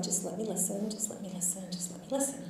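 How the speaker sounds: quiet self-talk. Retold or imitated. Doctor steadying herself before walking in, quietly. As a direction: say it under your breath like a private mantra. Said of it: "I told myself".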